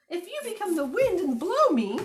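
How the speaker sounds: high, loud little bunny. Acted. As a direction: play the runaway bunny, bright and eager. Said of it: "said the little bunny".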